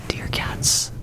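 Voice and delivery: ordinary talk, nothing marked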